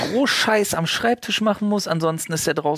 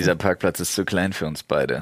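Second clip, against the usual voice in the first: mock cowboy showdown; 10 semitones lower than usual. To his friends, playing Walker the parking warden, low gunslinger threat. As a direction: drop the voice like a cowboy in a standoff.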